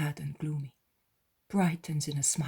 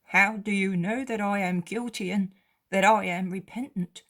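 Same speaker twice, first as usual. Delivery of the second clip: beggar voice; 4 semitones higher, 6 dB louder than usual. Acted. Beggar asks Jesus.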